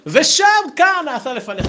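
ושם and כאן both high and loud; ושם כאן proclaimed in a high, loud voice; emphatic retold answer. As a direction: hit ושם כאן hard as one answer. Retold rabbi merging both words.

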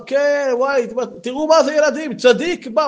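high, excited announcing; voicing an excited father; thrilled host. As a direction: show off the holy man to the kids. Host shows the tzaddik to kids.

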